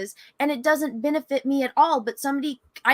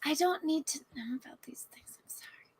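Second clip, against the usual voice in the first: embarrassed whisper; 5 semitones higher, 10 dB quieter than usual. Real, not acted. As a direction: breathe it under your voice. Embarrassed whisper about politics.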